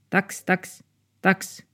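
high yappy barks; little dog barking. Acted. Small dog barks at two dogs outside, high yaps. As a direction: yap like a tiny dog.

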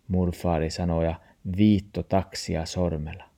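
usual speaking voice

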